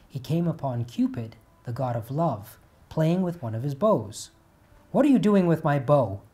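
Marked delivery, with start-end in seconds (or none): 4.9-6.2 sharp, louder demand from a proud god; angry. Acted. Apollo scolding Cupid over his bow, angry and loud. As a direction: confront the thief of your bow. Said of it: "Apollo asked angrily".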